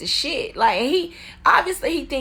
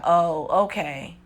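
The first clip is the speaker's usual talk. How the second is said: imitating deflated man; 6 semitones lower, slower than usual. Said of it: "He's like".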